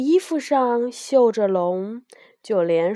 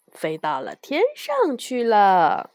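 ordinary delivery

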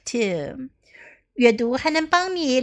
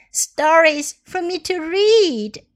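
put-on delighted child; 6 semitones higher, 4 dB louder, slower than usual. Clara delighted with books.

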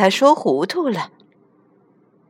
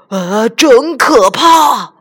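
loud, slow horrified cry; the lion, frightened, cries out in horror; terrified; 4 semitones higher, 8 dB louder, much slower than usual. A narrator's reading. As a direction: gasp it out in horror.

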